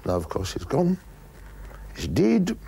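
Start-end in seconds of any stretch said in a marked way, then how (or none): 1.9-2.5 broad Scots DEAD, said "deed", pitched high; Scottish pronunciation. Acted. Telling of Hamish's death, broad Scottish accent. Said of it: "as we say in Scotland".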